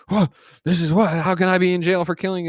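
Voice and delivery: high-pitched, indignant mock yell; retold yelling. Retelling the jailed man's outburst to co-hosts, mock yelling. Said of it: "he's, like, yelling and stuff".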